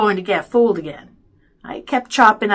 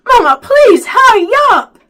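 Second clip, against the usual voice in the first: high, loud begging; urgent pleading; 13 semitones higher, 13 dB louder than usual. A narrator's reading. Boy begs mother to come rescue brother, urgent pleading. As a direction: beg at full voice.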